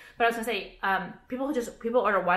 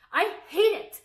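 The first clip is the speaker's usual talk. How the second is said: exasperated; 11 semitones higher, 4 dB louder than usual. Real, not acted. Ranting about women's store.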